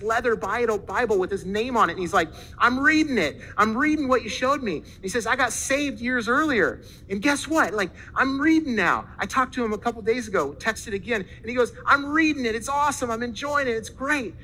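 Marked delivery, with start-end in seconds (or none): 2.6-4.8 high, emphatic retold enthusiasm; acted delight, pitched up; eager excitement. New reader's enthusiastic text acted out. 5.3-9.1 high, emphatic retold enthusiasm; acted delight, pitched up; eager excitement. New reader's enthusiastic text acted out. 11.9-14.4 high, emphatic retold enthusiasm; acted delight, pitched up; eager excitement. New reader's enthusiastic report acted out.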